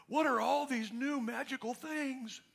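high, slow wonder; amazed. Acted. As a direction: marvel with hammed-up awe. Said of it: "I'm, I'm amazed".

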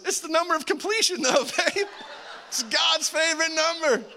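high, laughing sheepish plea; sheepish excuse. Retold or imitated.

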